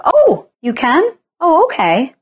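high, drawn-out fake surprise; pretend surprise. To husband, faking surprise at his offer, high mock-amazed. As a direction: act amazed that he can. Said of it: "And I just pretend".

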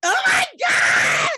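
loud, drawn-out high cry of shock; shocked shout. Real, not acted. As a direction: blurt it out in disbelief, stretched. Shocked watching live video.

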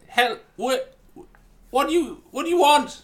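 high, loud startled demand; high indignant startled voice; startled character. Acted. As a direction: whirl round and snap who are you. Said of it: "I turn".

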